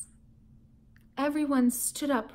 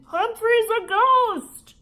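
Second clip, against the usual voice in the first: high, loud, slow delighted cry; excited kid shout; 9 semitones higher, 6 dB louder, slower than usual. A narrator's reading. Classmates shout at the ghost hamster, high, loud and slow. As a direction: squeal it like a thrilled child. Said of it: "shouts of".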